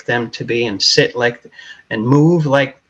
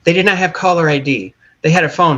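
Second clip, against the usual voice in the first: ordinary talk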